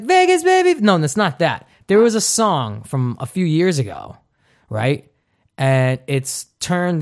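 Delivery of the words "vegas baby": high, mock party whoop; party catchphrase. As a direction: call it out like a Vegas reveler.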